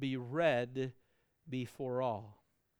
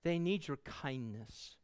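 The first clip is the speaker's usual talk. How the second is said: normal talking voice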